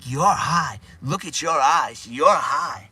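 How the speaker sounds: mock scolding. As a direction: act out catching a kid, punching each accusation. Retold catching kid smoking weed.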